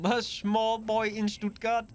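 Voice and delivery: high, drawn-out mock German accent; bad German accent. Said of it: "that bad German accent".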